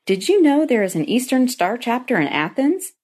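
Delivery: lively retold excitement with bouncing pitch; bubbly, enthusiastic. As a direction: bubble over with news for mom. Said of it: "in a bubbly, enthusiastic voice".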